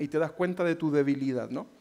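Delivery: normal talking voice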